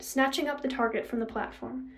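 ordinary delivery, nothing marked

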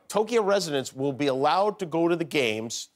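ordinary talk

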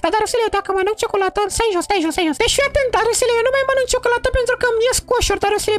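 put-on high falsetto girl voice; high put-on girl voice scolding her old friend. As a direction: play the offended girl in a high voice. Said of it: "trebuie să fac vocea".

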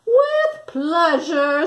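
slow, high, elegant flamingo voice. A narrator's reading. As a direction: draw it out graciously like a grand bird. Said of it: "said the flamingo".

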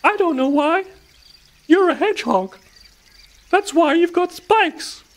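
very high, squeaky explanation; field mouse voice. A narrator's reading. Field mouse tells hedgehog why he has spikes, squeaky.

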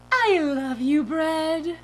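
high, loud gushing declaration; silly dream-self voice. Retold or imitated. Driver reunited with the bag of bread, loud gushing voice. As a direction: belt out adoration in a goofy voice. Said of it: "I sounded like that too".

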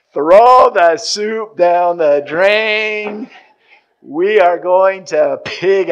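very slow, loud gleeful call; triumphant. Retold or imitated. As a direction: savour each word of victory. Father triumphant as food arrives.

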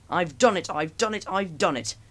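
high, loud triumphant cry; proud Gordon. A narrator's reading. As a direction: crow it like a proud engine at the top. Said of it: "he said proudly".

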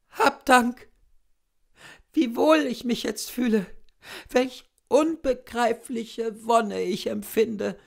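high, loud, pleading sick woman; a feverish invalid pouring out her heart; dying sick woman. A narrator's reading. Sick wife talking to her cousin, high and loud.

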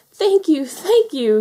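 high, loud excited yaps; yapping. A narrator's reading. Little foxes all at once to Mother Hen, yapping. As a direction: yap it like excited fox cubs. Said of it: "yapped all the little foxes".

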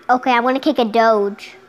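loud, excited call out. Real, not acted. Kid shouting at game character, loud. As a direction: shout it at the screen.

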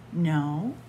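ordinary delivery

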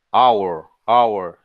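hour starting straight on the vowel, "awer"; hour said as "awer", opening on the vowel; silent H. Shown as a demonstration. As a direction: drop the H in hour entirely. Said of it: "es muda".

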